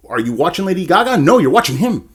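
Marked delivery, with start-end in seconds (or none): none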